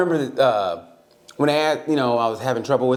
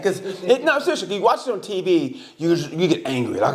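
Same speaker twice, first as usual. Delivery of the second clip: ordinary delivery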